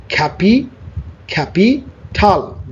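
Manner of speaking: "খ্যাপিটাল" split into two parts with a pause; broken into parts. Shown as a demonstration. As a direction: say "খ্যাপিটাল" slowly, খ্যাপি, then টাল. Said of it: "দুইটা ভাগ আছে". ("খ্যাপিটাল" দুই ভাগে ভেঙে ধীরে)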